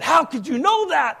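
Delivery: high, loud, astounded question; acted amazement. Preacher imitating the clerk's disbelief at being known, astounded. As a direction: demand to know, voice shooting up.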